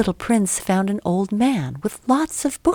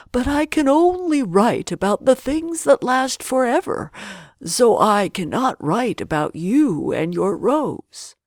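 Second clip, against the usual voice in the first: put-on old geographer; 4 semitones higher than usual. A narrator's reading. Geographer speaks.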